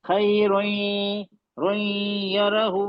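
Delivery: खैरोई यरहु stretched very slowly with nasal merge; teacher recites खैरोई यरहु, nasal held; slow ghunna recitation. Shown as a demonstration. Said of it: "नाक में ही चला गई".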